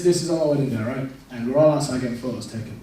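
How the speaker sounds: usual speaking voice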